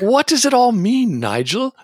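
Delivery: high, hammy British movie quote; put-on British film-character voice; Austin Powers. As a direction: swoop up high in a comic British voice. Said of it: "I have the whole second Austin Powers movie memorized".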